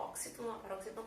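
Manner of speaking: ordinary talk, nothing marked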